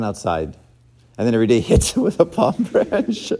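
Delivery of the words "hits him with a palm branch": laughing through it. Real, not acted. Laughing at funny story.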